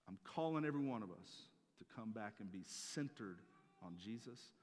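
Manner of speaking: ordinary talk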